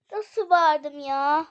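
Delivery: slow words broken by laughter; laughing disbelief. Real, not acted. Gamer to viewers about her scream, laughing. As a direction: laugh through it, amazed at yourself.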